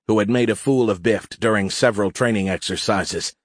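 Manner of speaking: usual speaking voice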